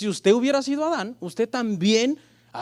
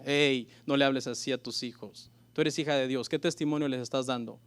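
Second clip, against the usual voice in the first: softer, lower inner voice of conscience; Holy Spirit rebuke; 6 semitones lower, 5 dB quieter than usual. Retold or imitated. The Holy Spirit rebukes a mother in her conscience, soft and low. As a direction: rebuke gently but firmly, low.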